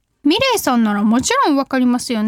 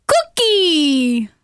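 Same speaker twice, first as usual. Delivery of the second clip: magic-word cheer; much slower than usual. Shown as a demonstration. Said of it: "magic word performed on request".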